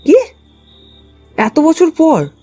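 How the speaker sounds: normal talking voice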